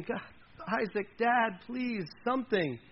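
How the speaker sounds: high, halting, acted-out begging; mock weeping plea. Son begging dad for anything, lightly acted weeping. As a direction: plead brokenly, slowing at the end. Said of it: "Esau broke down and wept".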